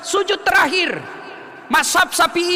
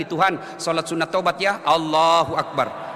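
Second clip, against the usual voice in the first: lower, slow and plain prayer words; simple spoken intention; 10 semitones lower than usual. Shown as a demonstration. Preacher shows the shortcut intention to a mother. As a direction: say it simply to God, slow on the takbir. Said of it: "bilang saja begini".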